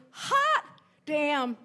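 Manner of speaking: high, loud, celebratory shout; triumphant proud exclamation. Retold or imitated. Mother bursting with pride in her son, in a triumphant shout. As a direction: crow it out as the big punchline.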